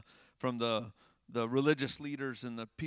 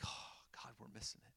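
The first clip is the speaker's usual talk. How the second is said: near-whispered, very soft and low; imagine realizing it, barely above a whisper; hushed realization; 6 semitones lower, 19 dB quieter than usual. Retold or imitated. Imagined reaction on overhearing Jesus crying.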